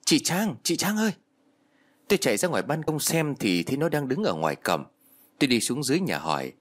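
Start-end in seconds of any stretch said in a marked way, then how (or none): 0.0-1.1 high-pitched narrated call up to a house. A narrator's reading. Linh calls Trang from outside the gate, calling out. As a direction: call out a friend's name, pitched well up. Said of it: "nghe thấy tiếng của cái Linh, em thằng Thiết, gọi tôi".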